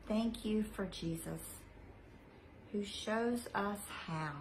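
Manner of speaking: slow, soft prayer voice. Acted. Leading children in a repeat-after-me prayer, gentle and slow. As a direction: pray gently, pausing for them to repeat.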